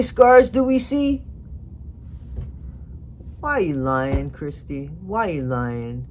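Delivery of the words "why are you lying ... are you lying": low, soft repeated questioning; hushed confrontation. Pretend. Vlogger confronting absent stepmom over lies, hushed and low. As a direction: press the accusation quietly, letting each question sink.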